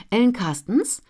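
bright, high, loud phone greeting; pointedly cheerful. A narrator's reading. Ellen answers the publisher's call forcedly cheerful. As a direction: put on a cheerful voice. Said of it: "meldete sie sich betont gut gelaunt".

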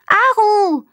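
high, loud call of a name. Acted. Minnie calling the dazed Aru, loud call. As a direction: call out to get her attention. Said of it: "rief Minnie".

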